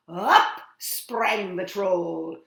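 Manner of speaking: loud, drawn-out story narration; big storybook jump-scare; dramatic sudden leap. A narrator's reading. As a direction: burst out on the first word, then stretch it. Troll leaps up in story.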